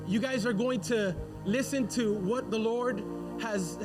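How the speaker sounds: ordinary talk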